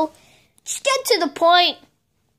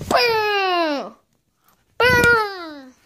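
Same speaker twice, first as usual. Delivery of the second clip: mock explosion boom; 4 semitones higher than usual. Explosion sounds.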